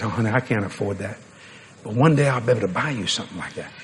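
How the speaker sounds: put-on young husband, low and soft to his wife; quiet, low-pitched apology to a sweetheart; hushed couple talk. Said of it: "they're just talking like this".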